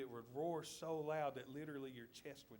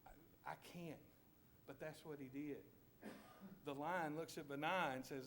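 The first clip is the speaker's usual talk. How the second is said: ordinary talk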